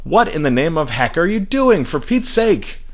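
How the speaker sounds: high, indignant fake-swearing rebuke; exasperated scolding. A narrator's reading. Father scolds his son, high exasperated voice.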